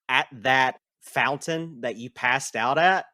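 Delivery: ordinary talk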